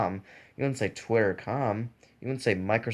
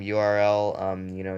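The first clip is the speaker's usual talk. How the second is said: ordinary talk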